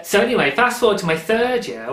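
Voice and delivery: usual speaking voice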